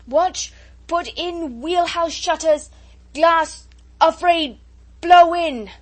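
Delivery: high, very loud broken shouts; yelling. A narrator's reading. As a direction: yell single words over a roaring gale. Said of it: "yelling, with his head down".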